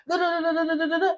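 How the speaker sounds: high, monotone run of nonsense syllables; mocking chatter. Storyteller mimicking a friend's rant, high mocking chatter. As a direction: mimic someone going on and on, pitched high.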